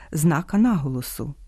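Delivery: usual speaking voice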